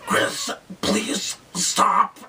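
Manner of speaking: high, slow, pleading mock sister voice. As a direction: beg him to stop, drawn out and high.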